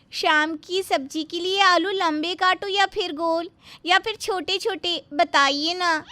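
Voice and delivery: high, loud, sweetly overeager; sugary bahu voice. Acted. Bahu asking her mother-in-law about cutting potatoes, sugary and high. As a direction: lay the sweetness on thick.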